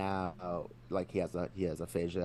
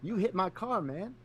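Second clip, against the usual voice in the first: high whiny dude voice; prank recording voice; 13 semitones higher than usual. Retold or imitated. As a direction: whine it like a stoner accusing. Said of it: "He's like".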